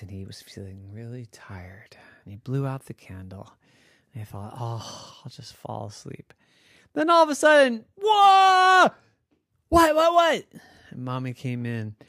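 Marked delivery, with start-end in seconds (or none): 0.0-6.3 sleepy, soft whisper. A narrator's reading. Falling asleep in story. 6.9-10.4 high, loud jolted awake cry; sudden burst of alarm; startled shout. Acted. Lights wake him suddenly.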